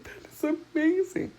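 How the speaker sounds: high-pitched mock weepy amazement; imitated tearful awe. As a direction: imitate a doctor moved to tears. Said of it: "he starts crying, like, sobbing".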